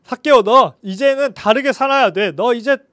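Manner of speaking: loud, stern pretend telling-off; mock scolding. Pretend Jesus scolding Zacchaeus to change, loud and stern. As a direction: scold him like a strict teacher.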